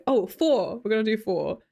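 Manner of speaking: ordinary delivery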